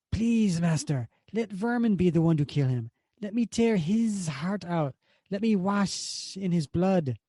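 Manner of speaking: slow, pleading monster voice; creepy begging creature, slow; pleading beast Vermin. Acted. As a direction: beg like a bloodthirsty creature. Vermin begs his master.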